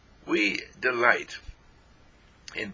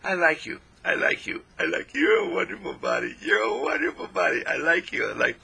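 higher, bright and gushing; gushing praise to your own body, pitched up; high cheerful self-talk; 8 semitones higher than usual. Shown as a demonstration. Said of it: "And you're saying".

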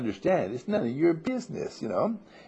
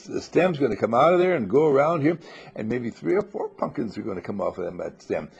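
ordinary talk

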